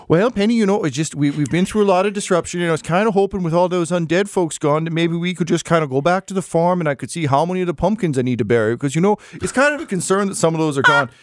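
a hint of a worried homebody husband; Ford, a fretful farmer. GM voices Ford.

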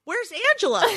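put-on high little-boy question; small child asking. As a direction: ask innocently in a small child's pitch. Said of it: "then he goes".

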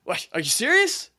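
high-pitched mock disbelief; baffled reaction. Retelling a candidate's clueless reaction in a clip, baffled. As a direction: imitate him, incredulous, pitch leaping way up. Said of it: "it was his reaction when he didn't know".

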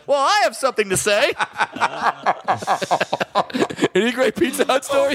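put-on high, laughing childish voice; teasing a friend, voicing his inner fat child. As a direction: ask it like an eager fat kid. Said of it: "inner fat child spoke up".

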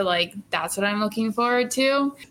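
normal talking voice

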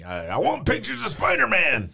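high, loud J. Jonah Jameson bark; barking newspaper editor. Retold or imitated. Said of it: "That's good".

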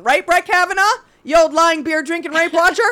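loud, high scornful scolding; mock judge berating. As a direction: tear into the defendant from the bench.

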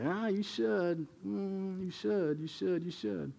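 mock a disapproving churchgoer, hushed; finger-wagging scold. Churchgoer wagging a finger at a struggling newcomer, mock scolding. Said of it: "wag their finger at you and say".

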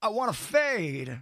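halting, slightly high celebrity impression; slow, oddly spaced phrases with stretched key words; Christopher Walken. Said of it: "So that's Christopher Walken getting".

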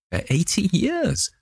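very high, laughing with a touch of triumph; triumphant laugh. A narrator's reading. Dorian echoing the answer, laughing in triumph. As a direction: let the relief break into laughter. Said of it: "laughed ... touch of triumph".

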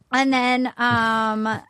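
ordinary talk, nothing marked

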